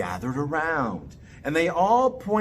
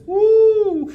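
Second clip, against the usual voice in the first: excited whoop; 9 dB louder than usual. Acted. Daddy running past.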